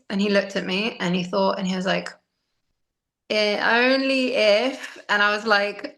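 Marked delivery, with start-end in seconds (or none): 3.3-5.0 put-on slow, drawn-out kid's condition; bargaining child. Seven-year-old answering his mom's breakfast request, slow bargaining. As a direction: draw it out like a child naming terms.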